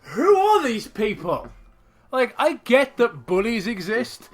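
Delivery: loud slow indignation; indignant rant. Real, not acted. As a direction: rant in disbelief. Ranting at the bullies.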